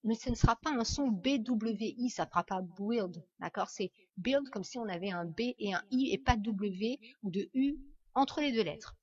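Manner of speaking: ordinary talk, nothing marked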